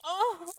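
high breathless laughing cry. Acted. Woman overwhelmed by pleasure, high laughing cry. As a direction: break into a high gasping laugh.

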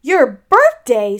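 loud, drawn-out astonished question; great surprise. Acted. Pooh learning it is Eeyore's birthday, greatly surprised. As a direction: exclaim it slowly in amazement. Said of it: "said Pooh in great surprise".

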